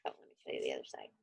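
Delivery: ordinary delivery, nothing marked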